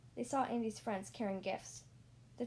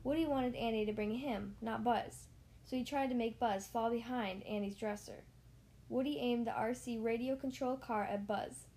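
usual speaking voice

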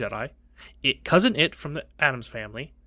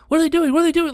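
much higher, louder frightened squeal; scared mom; 8 dB louder, much faster than usual. Retold or imitated. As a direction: screech it like a terrified mom. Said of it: "she wasn't doing the".

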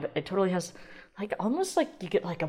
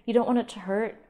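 ordinary talk, nothing marked

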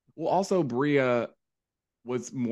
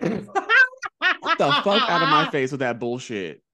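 laughing outburst; 7 semitones higher, 5 dB louder, much slower than usual. Real, not acted. Laughing rant at Jasmine.

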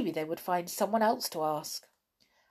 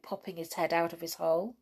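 plain reading voice

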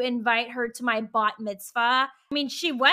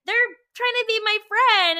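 high-pitched, gullible younger self; mock your own naive belief in a new friend; exaggerated innocent hopefulness, pitched well up; naive delight; 9 semitones higher, 4 dB louder than usual. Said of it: "so stupid and naive".

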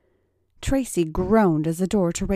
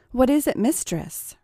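subservient; 6 semitones higher than usual. A narrator's reading. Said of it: "repeated Tracy more subserviently".

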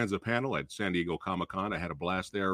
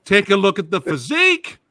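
loud, high showman call; hype announcer; 7 semitones higher, 7 dB louder than usual. Real, not acted. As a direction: sell him to the crowd. Host hyping a guest.

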